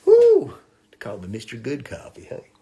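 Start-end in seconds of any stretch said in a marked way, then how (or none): 0.0-0.5 high, loud woo; whoop. Real, not acted. Cheering his good coffee, high loud whoop. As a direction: let out a piercing whoop.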